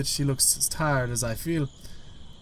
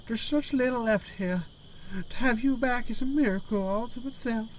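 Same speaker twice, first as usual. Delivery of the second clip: high put-on grandmother voice; female Gran voice; 8 semitones higher than usual. Gran relieved to have grandchild back, high grandmother voice. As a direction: play a grateful old gran, pitched high. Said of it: "a Scottish accent along with a female voice".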